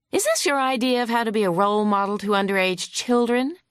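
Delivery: lightly voiced hostile lawyer. Opposing lawyer grilling the vet on the stand, mildly huffy. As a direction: press the witness with mild scorn.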